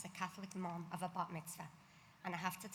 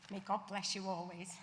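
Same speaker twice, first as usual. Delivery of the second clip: ordinary delivery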